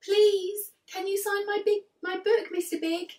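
excited autograph seeker; eager fan. Acted. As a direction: plead like a starstruck fan.

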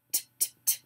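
"T" as a bare voiceless click of the tongue; soft repeated "T" bursts, no vowel; isolated end sound. Shown as a demonstration. Teacher showing the end sound, isolated consonant. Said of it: "t is the end sound".

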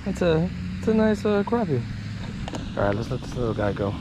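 normal talking voice

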